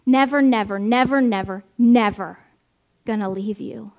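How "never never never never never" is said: string of "never" each punched loud and falling; "never" repeated five times, each hit hard; emphatic nevers. Shown as a demonstration. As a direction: pound out every "never" like a drumbeat. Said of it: "emphatic negations".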